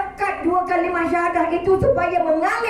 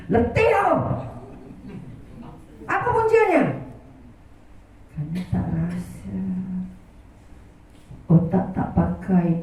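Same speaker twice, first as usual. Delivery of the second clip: usual speaking voice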